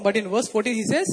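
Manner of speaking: normal talking voice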